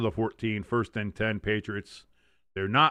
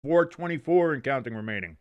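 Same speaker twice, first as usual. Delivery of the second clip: play-by-play; 2 semitones higher, 6 dB louder than usual. Acted. Play-by-play call of the game.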